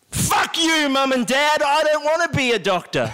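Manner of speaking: high, shouted rebellious outburst; angry teen shout. Pretend. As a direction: yell it at your parents. Magician shouting at parents.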